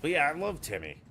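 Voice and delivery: excited gush. Real, not acted. Gushing about favourite streamer.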